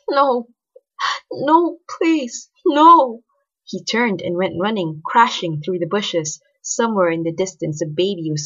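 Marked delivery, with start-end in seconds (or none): 0.1-3.2 high, frightened pleas building louder; whimpered pleading. A narrator's reading. Man begging the undead creatures for his life, high panicked pleading. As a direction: beg in a rising, panicky whimper. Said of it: "realizing it was his own voice".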